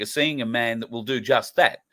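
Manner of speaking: normal talking voice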